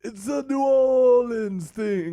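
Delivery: put-on very high, slow New Orleans caricature accent; mock American accent. Student shows professor his hammed-up New Orleans accent. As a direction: pitch way up, drawl a silly Southern American accent. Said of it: "Give me your best American accent".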